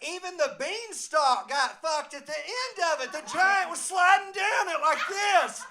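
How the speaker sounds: high, loud incredulous rant; worked-up shouting. Real, not acted. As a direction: holler the absurd climax at the crowd. Worked-up climax of porno story.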